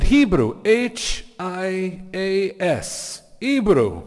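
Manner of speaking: put-on high, loud Venezuelan accent, letters drawn out slowly; excited imitation of a Venezuelan man; butchered Venezuelan accent. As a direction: spell it out emphatically in mock Venezuelan accent. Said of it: "I can't possibly do the accent right".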